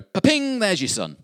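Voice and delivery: sudden high, loud "pa-ping" like a magic trick; magic reveal. Pretend. Wishing aloud God would just produce the son. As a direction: pop it out like a conjurer's reveal. Preacher voicing wished-for God.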